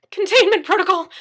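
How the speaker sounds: high, loud, terrified squeak; panicked squeak. Acted. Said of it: "Daniel squeaked".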